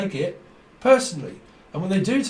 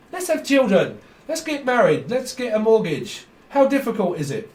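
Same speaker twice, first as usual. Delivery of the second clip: mocking high voice; 6 semitones higher, 3 dB louder than usual. Mocking naive life plans.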